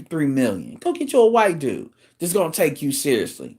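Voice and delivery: ordinary delivery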